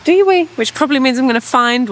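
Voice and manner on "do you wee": high, loud pet voice; a high, louder call to the puppy; coaxing the puppy. Real, not acted. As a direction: urge the dog on brightly.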